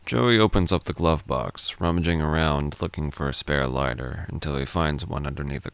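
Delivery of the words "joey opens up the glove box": ordinary delivery, nothing marked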